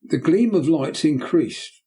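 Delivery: ordinary delivery, nothing marked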